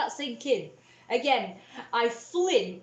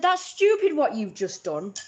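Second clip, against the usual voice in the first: mum's scornful snap; 4 semitones higher, 8 dB louder than usual. Retold or imitated. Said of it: "so she said".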